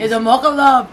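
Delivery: imitating a British barista; mock British "It's a mocha love", loud and quick. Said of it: "You would say mocha love as if it's one word".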